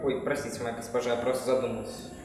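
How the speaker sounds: meek apology. Acted. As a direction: apologise sheepishly, quietly. Servant apologises timidly.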